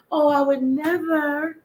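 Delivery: high, slow, prissy "I would never"; dainty co-host voice. Acted. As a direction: say it like a prim little lady.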